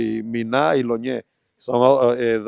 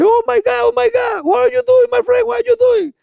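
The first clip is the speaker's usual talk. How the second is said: very high, loud, shrieking English cries; high-pitched frightened foreigner yelling oh my God; panicked friend; 5 dB louder than usual. Retold or imitated. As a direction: squeal it out like a terrified onlooker.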